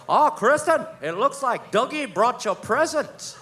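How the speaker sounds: high, lilting mock-delighted voice; falconer's show of surprise. Falconer presenting the owl's gift to Kristen, mock surprise. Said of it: "the Falcon guy goes".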